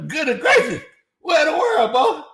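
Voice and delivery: high, loud disbelieving shout; shocked outcry. Real, not acted. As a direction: yell it in total disbelief. Shocked by the defense stats.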